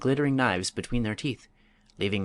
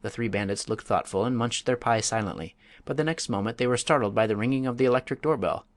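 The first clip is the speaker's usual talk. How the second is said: ordinary delivery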